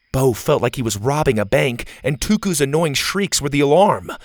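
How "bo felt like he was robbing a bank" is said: ordinary delivery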